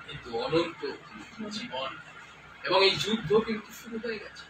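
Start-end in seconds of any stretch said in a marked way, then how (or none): 0.1-1.9 hushed close. Acted. Preacher drops to hush.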